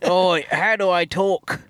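odd put-on rural British accent; mock the actor's strange rural British accent; Pattinson's weird accent. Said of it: "ever-changing accents".

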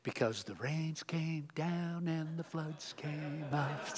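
a spooky storybook hush; storyteller whispering doom; dramatic storytelling. Acted. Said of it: "I told you I was gonna do it".

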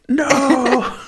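high mock cry of despair; mock wail. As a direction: wail it like a heartbroken farmer. Mock distress over cow.

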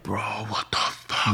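slow, soft unvoiced whisper; stunned whisper. Watching her car hit a tree, whispered shock. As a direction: breathe it out in disbelief. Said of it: "I'm like".

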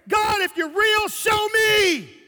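loud, high, drawn-out plea to God; shouted prayer. Retold or imitated. Retelling his challenge to God, shouted. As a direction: cry out slowly at full voice.

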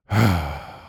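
a heavy, fed-up sigh; exasperated sigh. Retold or imitated. Imitating the impatient lady, a heavy exasperated sigh. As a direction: let out a long annoyed sigh. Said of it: "she was so irritated".

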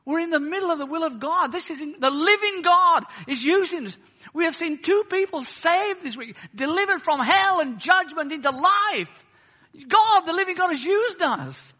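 loud, high, rousing pulpit cry; fervent preacher in full flow; impassioned preaching. Real, not acted. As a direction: preach it at full force, building to a declaration.

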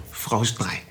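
low, soft name-drop; barely audible aside. Real, not acted. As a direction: slip the name out under the breath. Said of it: "Aber so leise, man hat's kaum gehört". (ganz leise, kaum hörbar)

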